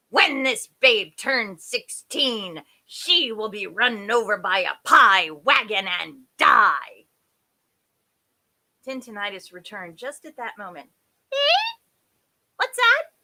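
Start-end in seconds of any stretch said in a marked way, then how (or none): 0.1-7.1 loud, emphatic wicked-fairy bark; barked curse. A narrator's reading. Wicked fairy cursing the baby, barking. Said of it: "and barked". 11.3-13.1 very high, loud query; half-deaf fairy. A narrator's reading. Half-deaf fairy asking about the curse, half-deaf. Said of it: "who was half deaf".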